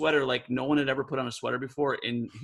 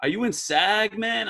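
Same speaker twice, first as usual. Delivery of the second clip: imitating a raised New York street drawl; lightly voiced New York guy asking the narrator a question; New York accent; 6 semitones higher than usual. Director quizzing the narrator, a hint of New York street accent. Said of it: "he goes".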